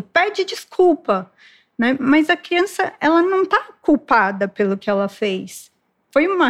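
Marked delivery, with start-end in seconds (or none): 0.0-1.3 put-on high, slow, scolding command to the child; a parent drawing out a demand for an apology; stern parental order. Parent at the playground ordering the child to apologise, stern high slow command. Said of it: "o pai e a mãe falando".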